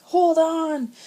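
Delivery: high, loud warning shout. A narrator's reading. Big brother shouts a warning before the ice hits. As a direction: yell it before the crash. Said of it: "shouted Sean".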